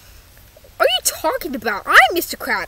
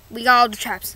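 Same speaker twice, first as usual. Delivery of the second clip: ordinary talk, nothing marked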